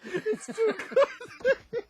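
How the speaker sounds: high, slow words squeezed out between laughs; too far gone to talk straight; laughing helplessly. Real, not acted. Host helpless with laughter on air.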